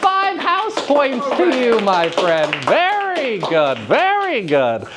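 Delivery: thrilled, high-pitched praise with a slow "very good"; delighted praise. Real, not acted. Teacher cheering a student who named The Raven, excited. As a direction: cheer the student like he just won a prize. Student answers quiz correctly.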